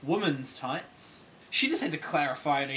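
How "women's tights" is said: slow incredulous. Retold or imitated. Lady clarifying, long pause.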